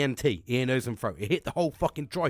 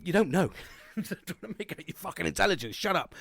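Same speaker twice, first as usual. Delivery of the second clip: laughing; 5 semitones higher than usual. Real, not acted. Laughing at his own mispronunciation.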